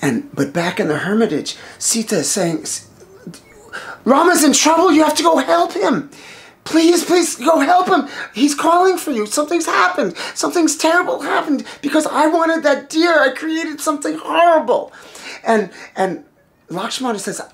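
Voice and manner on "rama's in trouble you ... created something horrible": high-pitched panicked urging; frantic pleading. Acted. Wife begs brother-in-law to rescue her husband, frantic. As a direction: plead urgently, voice pushed right up. Sita frantic at cry for help.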